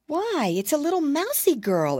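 surprised exclaim. Acted. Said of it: "exclaimed Curly Tail out loud".

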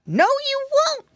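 very high, drawn-out retort; high-pitched piggy boy defiance; defiant cry. A narrator's reading. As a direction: cry it back high and slow. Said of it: "cried Flop Ear".

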